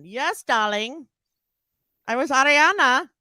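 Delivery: put-on a light Hungarian accent for Ariana Huffington; Ariana Huffington impression. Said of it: "I did Ariana Huffington".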